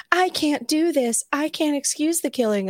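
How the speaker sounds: high, hammed-up protest; mock anguish. Acting out Jesse's sudden change of heart.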